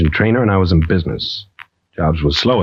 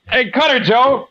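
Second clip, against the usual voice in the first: high hail across the hangar; calling out. Acted. Pilot calling his mechanic across the hangar. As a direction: call a mate from far off.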